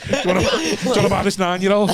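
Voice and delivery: high, laughing hawker's pitch; laughing mock seller. Joking with friends as a market seller, laughing. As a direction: sell it like a market trader while laughing.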